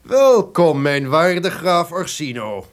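falsely warm welcome. Acted. Duke greets his intended victim.

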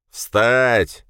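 loud barked command; yelling. A narrator's reading. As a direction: yell right into his ear. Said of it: "заорал у меня над ухом".

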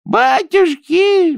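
high, drawn-out, loud cry of alarm; a wail as she falls; sobbing cry. A narrator's reading. Baba Dunya wails as Ninka knocks her down. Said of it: "всхлипнула баба Дуня".

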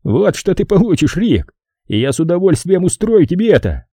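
high-pitched, gleeful threat; Kirk, threatening. A narrator's reading. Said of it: "прошипел Кирк". (угрожающе, высоким голосом)

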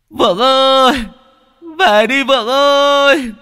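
wailing cry. Acted. Father on the riverbank wailing for his missing son. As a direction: howl the son's name across the river in grief. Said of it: "khóc váng cả lên".

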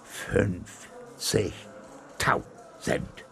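very slow, broken syllable by syllable; stammering. A narrator's reading. Captain receives the finder's reward, stunned stammering. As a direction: stammer it out in stunned gasps, a gap after each syllable. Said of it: "stammelte er".

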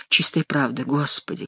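low, soft mock contrition; hushed put-on remorse; repentant. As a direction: admit it quietly to God. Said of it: "каялся Шико от имени короля".

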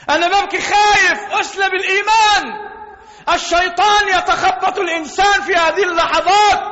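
fervent preacher; preaching at full force. Acted. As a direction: press the danger of the last moments. Preacher's raised passage on death.

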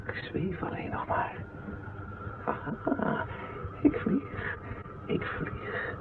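hushed, breathless whisper of wonder; awed whisper. Acted. Man floating alone whispers in awe at flying. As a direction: whisper it as if afraid to break the spell.

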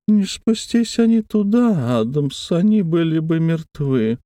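a thin eerie wheedle; thin wheedling voice. A narrator's reading. Brose's thin high voice.

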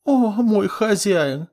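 high, slow, sing-song mockery; mocking. A narrator's reading. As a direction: mimic him with scorn. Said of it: "передразнивая".

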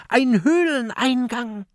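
high, eager exclamation; excited call. Acted. Mathilda announces the cave entrance, high and eager. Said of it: "ruft Mathilda".

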